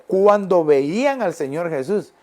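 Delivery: usual speaking voice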